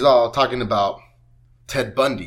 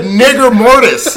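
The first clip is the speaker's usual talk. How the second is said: laughing retelling; 8 semitones higher, 9 dB louder, slower than usual. Retold or imitated. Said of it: "I said".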